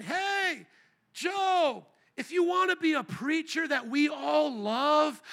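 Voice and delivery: high, drawn-out coaxing call; devil's enticing call. Acted. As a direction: tempt him in a high, slow singling-out call. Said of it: "isn't that what the devil's saying".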